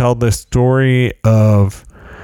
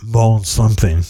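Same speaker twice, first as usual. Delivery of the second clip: posh French. Shown as a demonstration. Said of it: "posh Paris sounding name".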